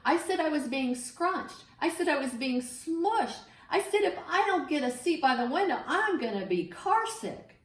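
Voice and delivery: rising, whining protest; whiny complaint. Acted. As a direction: complain like a squashed kid. Whiny boy complains in carpool.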